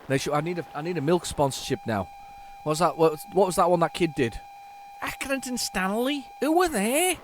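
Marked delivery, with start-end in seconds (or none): 5.0-7.2 put-on high-pitched kid's Scouse accent. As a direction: do the advert kid in drawn-out, high Scouse. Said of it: "Scouse accent there".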